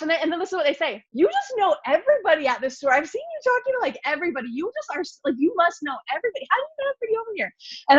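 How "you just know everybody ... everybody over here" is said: high-pitched, mimicked astonishment; amazed exclaiming. Family sees she knows everybody, imitated amazed exclaiming. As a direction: exclaim it far higher, imitating an amazed relative.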